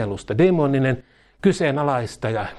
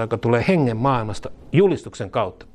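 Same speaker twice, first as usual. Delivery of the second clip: normal talking voice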